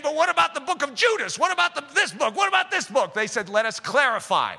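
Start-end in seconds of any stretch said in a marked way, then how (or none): 0.0-3.1 higher, whiny questioning of the skeptics; mock objector. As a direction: needle him with the skeptics' doubts. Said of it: "other people were saying".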